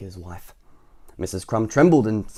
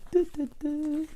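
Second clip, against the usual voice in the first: high, soft little "du" steps; tiptoe noises; 5 dB quieter, slower than usual. Shown as a demonstration. Reader imitating Mister Noisy tiptoeing up the hill, tiptoe noises. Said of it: "An extra quiet".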